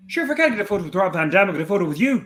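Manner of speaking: high, quick Irish fan voice; Irish fan's cheeky offer, quick and high; Irish accent. Retold or imitated. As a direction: rattle it off like a chirpy Irish fan. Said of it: "The Irish accent".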